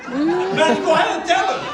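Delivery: nudging the brother to spill it, gleeful and loud; loud teasing push. Retold or imitated. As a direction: egg him on with glee.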